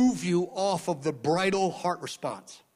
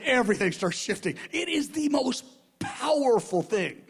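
ordinary talk

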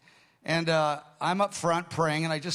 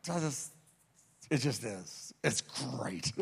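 low, soft, halting contentment; drained but blissful; wrung out but happy; 5 semitones lower, 8 dB quieter, slower than usual. Retold or imitated. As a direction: murmur it, too spent to explain. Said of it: "Wrung out, but happy".

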